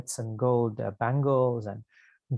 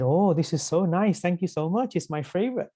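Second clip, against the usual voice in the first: higher, lightly voiced delighted child; delighted thanks; 5 semitones higher than usual. Krishna thanking Govardhan for sweets, delighted. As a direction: gush a little over a treat.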